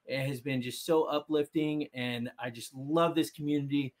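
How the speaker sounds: ordinary talk, nothing marked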